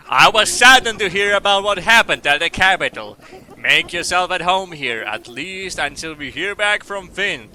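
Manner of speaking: slow, booming, drawn-out preacher; Edgar Oakhart, preacher. Acted. Temple preacher welcoming a stranger, slow sonorous voice. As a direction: intone it grandly, loud opening slowing to a stretched finish.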